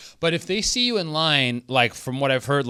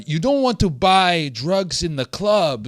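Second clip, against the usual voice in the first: put-on German accent; 6 semitones higher, 4 dB louder than usual. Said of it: "that's my German accent".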